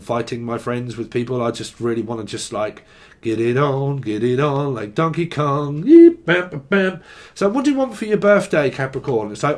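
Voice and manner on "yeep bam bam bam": mock punch noises. Sound effect punches.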